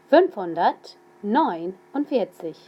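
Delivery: slow dictation of Fünfhundertneunundvierzig; Fünfhundertneunundvierzig said slowly, each part separate; slow and clear. Shown as a demonstration. Teacher dictating a number slowly to students. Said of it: "I'll say them slowly".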